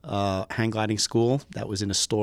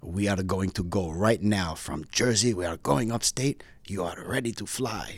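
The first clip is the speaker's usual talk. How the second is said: put-on heavy Greek accent; 3 dB quieter than usual. Instructor announcing the trip to his student, heavy Greek accent. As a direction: imitate a Greek instructor, word by word. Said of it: "in a very heavy accent".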